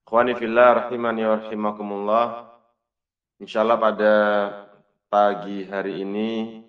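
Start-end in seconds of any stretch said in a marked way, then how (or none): none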